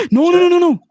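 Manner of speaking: very high, loud string of no's; gasp, then squeal out a protest; high-pitched startled objection; alarmed protest. Real, not acted. Sudden protest after a gasp.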